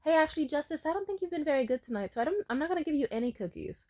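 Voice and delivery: high, quick, sing-song mock mom; backtracking mother. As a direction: imitate a mom chirping bad news fast. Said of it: "she's like".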